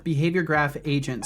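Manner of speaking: ordinary talk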